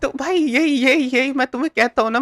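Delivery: high, rushed, laughing chatter; excited babble. Real, not acted. Streamer rejoicing that people became his fans, fast excited chatter. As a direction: tumble the words out in glee.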